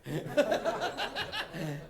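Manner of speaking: laughing. Real, not acted. Laughing through a retold reply.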